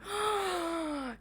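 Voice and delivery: high, drawn-in shocked "oh"; theatrical gasp of realisation; mock outraged gasp. Bride catching out mother-in-law, mock outraged gasp.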